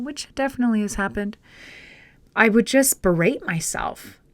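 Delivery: usual speaking voice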